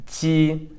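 ji held long on a high level tone; pinyin J demo. Shown as a demonstration. Teacher showing pinyin J, demonstrating ji. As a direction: draw ji out high and even, lips stretched. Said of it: "pinyin J is pronounced".

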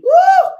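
high, loud whoop; preacher's whoop. Real, not acted. Preacher whooping at the congregation. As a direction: let out a joyful woo.

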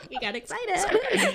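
high giggly words; laughing. Real, not acted. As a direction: talk through giggles. Laughing after the shout.

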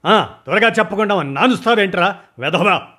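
high, loud rebuke; angry scolding. Acted. Boss scolds his dawdling man, loud and high. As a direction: snap at him to hurry up. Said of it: "గదమాయించాను".

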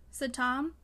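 usual speaking voice

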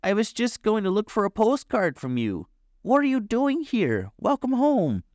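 high, eager nephew's voice; high-pitched surprised questioning; excited welcome. A narrator's reading. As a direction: greet a long-lost uncle in delight. Gobo excitedly welcomes his uncle.

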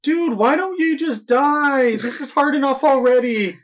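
very high, loud, drawn-out whine; exasperated mock wail. Killer struggling with a victim who won't die, exasperated wail.

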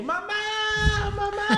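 put-on high, wailing kid's call for mom; mock a lost little boy bawling for his mother; hammed-up crying child calling for mom. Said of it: "Siempre lloraba como pendejo".

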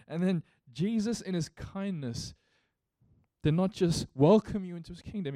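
loud, high, impassioned preaching; full-force preaching. Acted. Pastor urging the church to remember grace, in loud impassioned preaching. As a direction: preach it, lift the congregation. Pastor builds to rousing appeal.

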